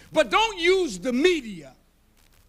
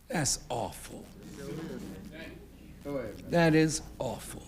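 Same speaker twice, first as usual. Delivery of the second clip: whispered, much softer and lower; breathy whisper of disappointment; hushed lament; 10 semitones lower, 9 dB quieter than usual. Acted. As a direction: let the words fall away in sorrow. Lament over being branded.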